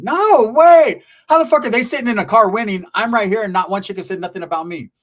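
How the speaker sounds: loud, high disbelieving No way; indignant outburst. Retold or imitated. Narrator outraged no girl noticed him, loud and high.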